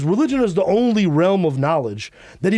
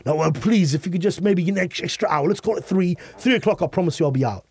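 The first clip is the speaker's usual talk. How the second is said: higher, pleading British voice; British accent; 4 semitones higher than usual. Acted. Guest haggling for a later checkout, pleading British accent. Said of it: "we use our British accents".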